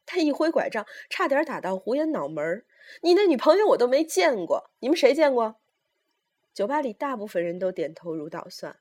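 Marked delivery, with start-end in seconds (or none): 3.0-5.6 high, loud demand; old mother's heated appeal; indignant challenge. Acted. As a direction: challenge the whole room.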